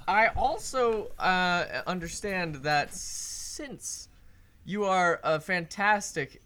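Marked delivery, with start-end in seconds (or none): none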